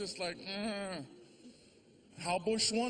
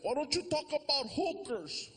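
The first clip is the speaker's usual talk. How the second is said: higher, whiny friend voice; mock annoyed friend; 6 semitones higher than usual. Friend Mando demanding hooker jokes, higher. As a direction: play the grumbling buddy.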